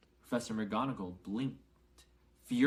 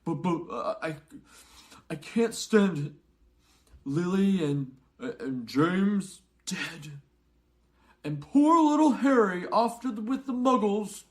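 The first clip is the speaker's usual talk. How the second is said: stammering, much higher and louder through tears; sobbing lament; 9 semitones higher, 10 dB louder than usual. A narrator's reading. Hagrid grieves for Lily, James and Harry, sobbing. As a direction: wail it out, breaking down. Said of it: "sobbed Hagrid".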